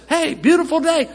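high, bright-up hello; cheery greeting. Retold or imitated. Pastor greeting a neighbor walking by, high and cheery. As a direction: greet a passerby sweetly.

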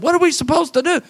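high-pitched exasperated parent; acted helpless frustration; frustrated. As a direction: imitate parents at their wits' end, voice way up. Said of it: "The parents came in all frustrated".

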